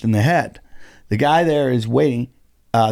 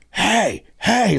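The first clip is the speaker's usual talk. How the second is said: raised, higher calling out of a comic; retold shout; 7 semitones higher than usual. Comic yelling from stage at a man who punched someone, retold shout. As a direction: bark it across the room to stop him.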